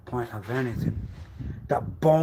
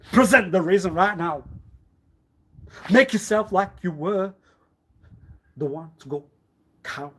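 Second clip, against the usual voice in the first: loud, higher orders; commanding demand; 5 semitones higher, 8 dB louder than usual. Acted. Ordering him to explain, loud demand.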